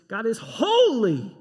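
loud, slow, exclaimed proclamation. Shown as a demonstration. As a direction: proclaim it with full force, slowly. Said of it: "it is super exclamatory".

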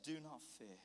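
hushed, low comfort; calm reassurance. Acted. God's word of hope through his man, calm.

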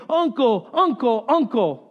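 mock scream of a tortured kid, short falling cries; grito imitado. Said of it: "until the kid would scream".

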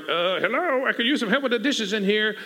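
lightly acted put-upon sister; mildly miffed plea for help; a touch of kitchen exasperation; exasperated complaint. Acts Martha complaining about dishes.

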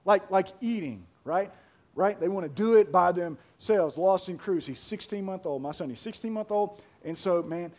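ordinary delivery

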